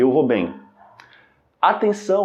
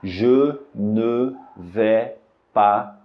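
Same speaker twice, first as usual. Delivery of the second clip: "Je ne vais pas" drawn out, "bien" falling; slow careful phrase; very slow correct demo; 3 semitones lower, much slower than usual. Shown as a demonstration. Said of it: "a pronúncia correta da frase é".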